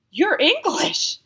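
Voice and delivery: high, loud, slow astonished gasp. A narrator's reading. Rattled narrator gasps at the buck in disbelief. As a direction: gasp it out in shock. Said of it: "I gasped out".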